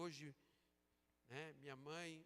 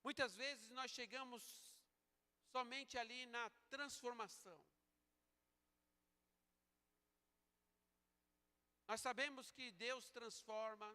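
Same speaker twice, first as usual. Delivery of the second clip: loud, higher, slow pulpit delivery; full-force preaching; 7 semitones higher, 6 dB louder, slower than usual. Real, not acted. Preacher challenging the congregation on true followers of Christ, forceful preaching. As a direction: preach it out slowly, in a raised voice.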